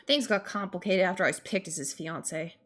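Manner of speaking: ordinary talk, nothing marked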